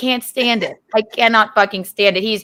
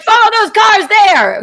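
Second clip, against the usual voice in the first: higher, louder mock urgency; mock urgent order; 8 semitones higher, 5 dB louder than usual. Wife imitating husband in the car, mock urgent.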